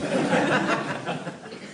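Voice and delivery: amused giggle at the Chevalier joke; real laugh. Real, not acted.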